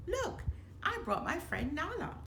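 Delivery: high eager cub voice, bright and keen; a little lion cub showing off his friend; young cub Simba. A narrator's reading. Cub Simba to uncle Scar, high and eager.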